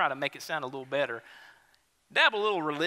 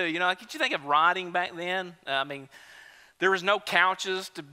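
ordinary talk

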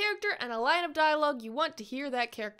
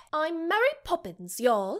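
put-on Mary Poppins British accent with y'all; prim British nanny saying y'all. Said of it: "say y'all with a British accent".

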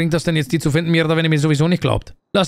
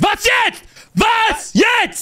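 screamed, much higher and disbelieving; outraged shout; much slower than usual. Acted. Accused prisoner yelling at the police in outrage. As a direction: yell it at the cops in outrage.

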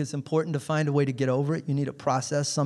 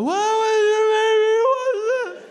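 high, loud mock wail through sobs; hammed-up sobbing kid; imitating bawling child; 6 dB louder than usual. Brother voicing his crying daughter, mock bawling. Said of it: "she's just bawling her eyes out".

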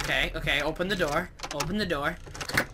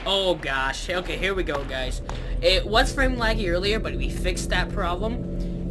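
usual speaking voice

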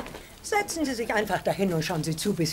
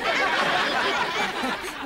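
bursting out laughing; a sudden high hearty laugh; loud laugh; 8 semitones higher, 5 dB louder than usual. Acted. Laughs at peach juice offer.

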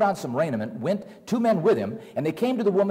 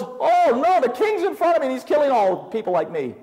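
much higher, louder panicked cry, fast at the end; frightened yell; 9 semitones higher, 3 dB louder than usual. Acted. Witch terrified at finding the king before her, yelling. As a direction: shriek in terror, then rush the words. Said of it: "And she yelled".

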